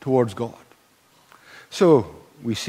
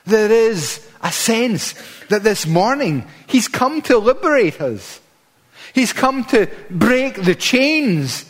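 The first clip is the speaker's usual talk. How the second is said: preaching at full force; 6 semitones higher, 4 dB louder than usual. Acted. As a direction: lift the room, proclaiming liberation.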